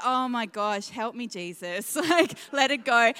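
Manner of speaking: ordinary talk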